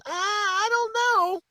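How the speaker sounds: high, drawn-out doubtful whine; mock a hesitant sidekick, very high and slow; Wayne. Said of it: "Wayne goes".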